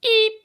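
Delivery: squeak. Acted. Comic squeak after ostrich joke.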